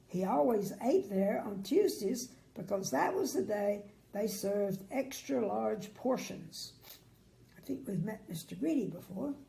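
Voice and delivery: usual speaking voice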